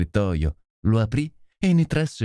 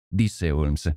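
ordinary delivery, nothing marked

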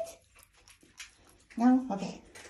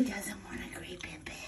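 whispering; 2 semitones lower, 9 dB quieter than usual. Real, not acted. Whispered playful question.

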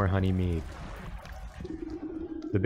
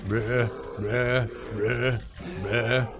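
bear grunts; much slower than usual. Shown as a demonstration. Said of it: "That's what the bear sounds like".

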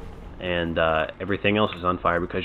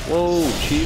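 startled whoa; 9 semitones higher, 7 dB louder than usual. Real, not acted. Dodging enemy attack.

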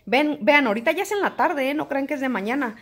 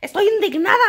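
high, louder outraged complaint; mock indignant viewer; 8 semitones higher, 3 dB louder than usual. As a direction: play an offended busybody. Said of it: "me reclamaba así como".

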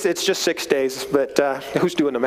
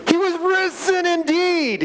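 high, slow, jubilant proclamation; joyful exclamation; 13 semitones higher than usual. Real, not acted. Preacher rejoicing over the resurrection, high and slow. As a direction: let it ring out with joy.

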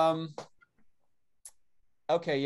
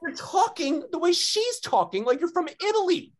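retold outrage, pitched way up; embarrassed scolding; 6 dB louder than usual. Embarrassed son calls out dad for mimicking her, exasperated. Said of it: "so embarrassing".

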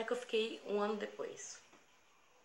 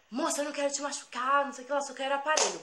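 high, louder rush of demands; acted-out mugger; 7 semitones higher, 6 dB louder than usual. Young robber to girl at bus stop, hurried demand.

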